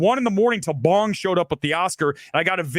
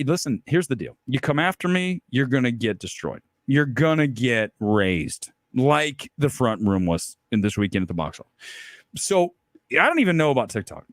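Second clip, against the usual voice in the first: normal talking voice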